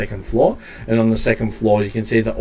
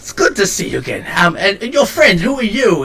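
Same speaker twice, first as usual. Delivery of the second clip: higher-pitched, eager character voice; excitable shopkeeper; 10 semitones higher, 4 dB louder than usual. Acted. Shopkeeper greeting a friend and the newcomer, high and eager. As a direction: greet them in a rush of high-pitched enthusiasm.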